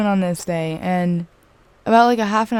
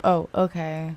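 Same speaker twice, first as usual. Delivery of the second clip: soft, low, matter-of-fact reply; straight-up, unfazed; 3 semitones lower, 7 dB quieter, slower than usual. Retold or imitated. Showing how friend answered the confession, straight-up unfazed. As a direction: imitate an unbothered, non-awkward acknowledgement. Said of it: "It was just straight up".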